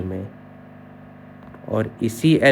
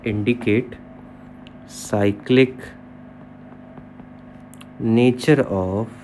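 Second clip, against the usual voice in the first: slow dictation; 2 semitones lower, 3 dB quieter, much slower than usual. Shown as a demonstration. Teacher dictating a definition to students.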